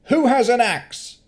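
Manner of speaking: high, loud call to the crowd; rally the mob. Acted. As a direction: townsman on the podium calling out. Townsman calls to crowd.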